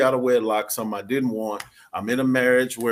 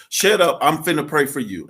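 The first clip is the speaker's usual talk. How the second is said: louder second telling of the pastor's line; quoting pastor louder; 5 semitones higher, 7 dB louder than usual. Retold or imitated. Husband retelling his pastor's blunt order, louder. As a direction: punch it out louder like his brother. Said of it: "He said".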